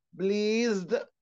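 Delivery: بليزد with a stretched "ee"; long E in بليزد. Shown as a demonstration. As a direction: hold the middle vowel of بليزد. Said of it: "بمد في الإي". (مدّ الإي في «بليزد»)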